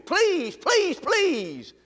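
high, pleading please, please, please; pleading cry. Acted. Preacher begging the congregation, high pleading cry. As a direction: beg them like your life depends on it.

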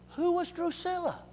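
high, soft intrigue; hushed dramatic question. Real, not acted. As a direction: ask it teasingly.

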